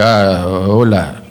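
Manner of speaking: halting broken Spanish, low and slow; slow, low, clumsy attempt at Spanish; Steven Seagal. Seagal to Spanish speakers, horrifically broken Spanish. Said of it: "speaking horrifically broken Spanish".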